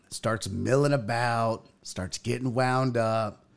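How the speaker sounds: normal talking voice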